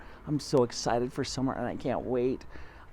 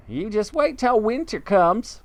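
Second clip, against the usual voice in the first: high bird voice; roly-poly bird; 8 semitones higher, 4 dB louder than usual. Acted. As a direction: chirp it like a friendly bird. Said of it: "the roly-poly bird said".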